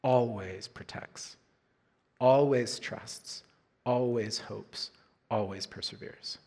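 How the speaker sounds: scripture reading. Acted. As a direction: let each short phrase settle, softly and deliberately.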